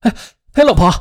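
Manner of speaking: high urgent call; calling after her. A narrator's reading. Husband chasing his departing wife, calling out.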